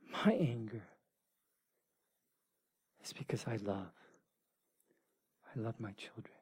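a tender whisper of love. Acted. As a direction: breathe it, barely audible. God's love, voiced softly.

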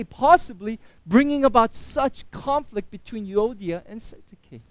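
ordinary talk

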